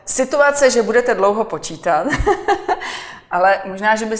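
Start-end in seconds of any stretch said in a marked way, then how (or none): 2.1-3.3 short high-pitched laugh; real, amused giggle; teasing laugh. Real, not acted. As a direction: laugh at your own gentle tease. Teasing about years of marriage.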